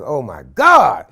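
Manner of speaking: loud, slow horrified gasp; the dread hits. Retold or imitated. Father hearing the lion has his daughter, horrified.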